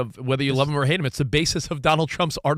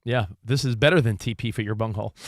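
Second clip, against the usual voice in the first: ordinary talk, nothing marked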